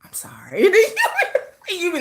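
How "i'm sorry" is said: much lower, smooth sorry; a deep, easy apology. Retold or imitated. Voicing [name] apologising, a low smooth sorry.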